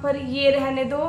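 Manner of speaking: usual speaking voice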